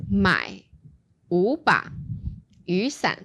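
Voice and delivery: slow phrasing with pauses between units; grouped in units. Shown as a demonstration. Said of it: "So it goes".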